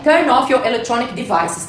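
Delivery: ordinary talk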